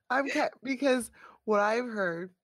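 ordinary delivery

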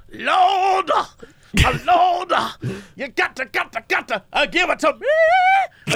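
high, hollering revival preacher; fiery preacher. Retold or imitated. Mocking a revival preacher for a friend, high hollering. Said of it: "I like the guy who's like".

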